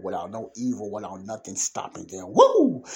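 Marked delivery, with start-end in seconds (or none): 2.3-2.8 a high, loud preacher's whoop of joy; excited whoop. Real, not acted. Excited preacher mid-sermon.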